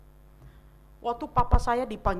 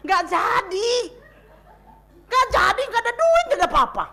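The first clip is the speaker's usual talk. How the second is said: high, loud whining lament; petulant wail; 12 semitones higher, 10 dB louder than usual. Retold or imitated. Grieving teen wailing about losing the reward, high and loud. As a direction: wail over the lost promise.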